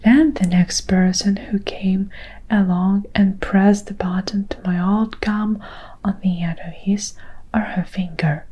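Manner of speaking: plain reading voice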